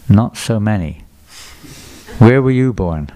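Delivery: normal talking voice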